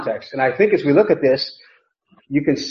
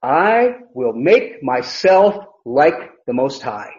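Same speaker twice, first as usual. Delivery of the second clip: each word of "I will make myself" set apart, slow; slow, weighty boast with "I" drawn out; literal, word-by-word emphasis; 3 semitones lower, much slower than usual. Shown as a demonstration. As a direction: spell out "I will make myself" with deliberate pauses.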